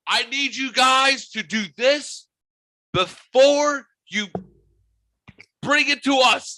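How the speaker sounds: very high, loud and slow mock voice; hammed-up high-pitched bossy request. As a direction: stretch it out in a high cartoon voice. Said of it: "it was like".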